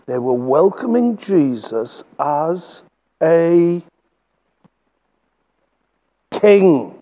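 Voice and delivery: slow, with a long pause before king; slow emphatic build. Shown as a demonstration. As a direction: build the suspense then land king. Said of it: "now this is the most important bit".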